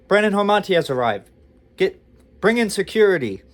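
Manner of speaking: loud, high, urgent announcing; mock VIP announcement. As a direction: proclaim an arrival like an alarm. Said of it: "like I'm some famous rich guy".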